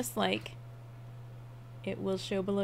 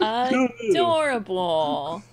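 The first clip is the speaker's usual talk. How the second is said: cooing; 2 semitones higher, 6 dB louder, much slower than usual. Real, not acted. Reacting to cute anime drawing.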